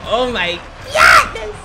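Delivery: slow start bursting into high scream; shrieked astonishment. Pretend. Gamer amazed by slippery escape, shrieked astonishment. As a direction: explode on GOODNESS in a high shriek.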